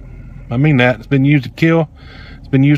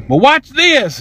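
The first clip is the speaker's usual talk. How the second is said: high, swooping dare; defiant challenge; 10 semitones higher, much slower than usual. Retold or imitated. Customer calling after the woman walking off, defiant challenge. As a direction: pitch it way up as a challenge. Defiant threat as she walks off.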